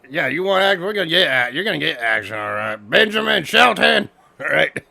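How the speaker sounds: high, loud mock promo; imitated retort. Co-host re-enacting the promised action, loud high mock promo. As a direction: punch it up, loud and high on the name. Acted retort from the wrestling segment.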